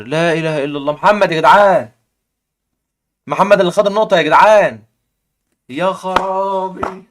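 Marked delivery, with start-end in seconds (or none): 0.9-4.8 loud and higher, thrilled at the point; real excitement over the chess upset; excited. Real, not acted. 5.7-7.0 very slow, stretched-out exclamation of disbelief; stunned. Real, not acted.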